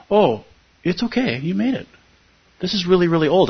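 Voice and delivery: soothing therapist. Retold or imitated. Voicing therapist reassuring the body.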